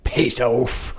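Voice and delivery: put-on British swearing; British accent. Shown as a demonstration. Player echoing the host's phrase, mock British accent. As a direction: say it as a jokey Brit. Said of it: "We're gonna go standard British".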